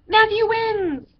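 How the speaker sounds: high, loud, slow cheer; yelled announcement. A narrator's reading. Judges yelling Matthew's victory to the beach. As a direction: proclaim the winner with joy. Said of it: "they yelled".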